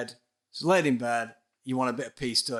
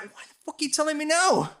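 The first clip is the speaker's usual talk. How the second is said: exasperated outburst; 4 dB louder, faster than usual. Retold or imitated. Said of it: "I'm like".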